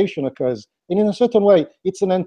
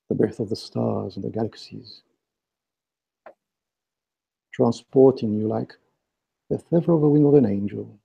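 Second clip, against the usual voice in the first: right in your ear; 6 semitones lower, 4 dB quieter than usual. Acted. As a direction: guide them inward, hushed and close. Leads guided meditation with breathing.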